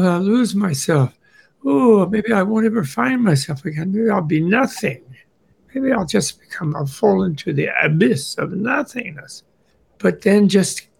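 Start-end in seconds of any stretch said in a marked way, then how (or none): 0.0-9.4 fretting about getting lost, pitched up; anxious inner voice. Acted. Meditator fears falling into the abyss, mock anxious. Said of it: "where maybe".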